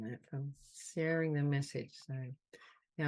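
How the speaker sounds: ordinary delivery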